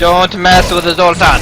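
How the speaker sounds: loud, booming put-on witch doctor voice. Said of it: "that sounded more like, like the witch doctor".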